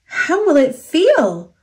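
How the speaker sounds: put-on high, curious little girl; high child's voice, curious; young girl's voice. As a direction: wonder aloud like a small child. Rosita wonders aloud, child.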